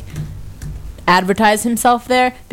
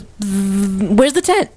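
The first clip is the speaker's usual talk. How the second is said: buzzing kid impression; much slower than usual. Imitating strange kid looking for his tent, buzzing then asking. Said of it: "He goes".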